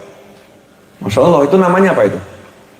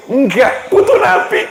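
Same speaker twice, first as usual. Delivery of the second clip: playful high correction. Acted.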